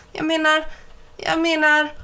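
mocking imitation. A narrator's reading. Mike mimics Ben's stammer to tease him, high sing-song. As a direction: parrot Ben's "Jag menar" to mock him. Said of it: "Han älskade att härma Ben".

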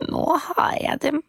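high, whispered gloat to herself; gloating witch whisper. Acted. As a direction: whisper it, relishing the catch. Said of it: "sa ved seg selv".

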